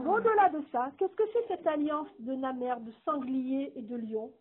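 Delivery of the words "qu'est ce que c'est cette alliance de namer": normal talking voice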